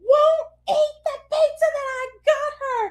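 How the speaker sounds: high-pitched, tearful exasperated wail; mock hysterical complaint. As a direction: wail it like a mom losing it. Acts out hysterical complaint to husband.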